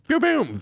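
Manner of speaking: high-pitched "pew, pew" gun noises; mock gunshots. Host jokes about shooting in Florida, mock gunshots. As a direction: imitate a little gun in a high voice. Imitates gunfire as a joke.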